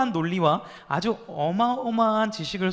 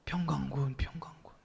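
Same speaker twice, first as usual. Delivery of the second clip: faint breathy whisper, fading to almost nothing; weak old man's whisper, barely audible; dying-bug whisper; 4 semitones lower, 9 dB quieter than usual. Retold or imitated. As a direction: whisper like a dying insect calling out. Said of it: "벌레가 죽은 소리였습니다".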